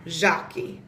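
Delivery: Jackie, J like the s in measure; Portuguese pronunciation. Shown as a demonstration. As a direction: say Jackie as "Zhah-kee". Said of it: "But in Portuguese".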